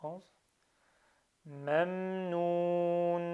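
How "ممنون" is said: ممنون slowly, with a long held "uu"; prolonged vowel. Shown as a demonstration. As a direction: stretch the waw in ممنون before the final n. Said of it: "ça, c'est un prolongement".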